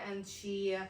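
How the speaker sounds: usual speaking voice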